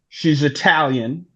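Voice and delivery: ordinary talk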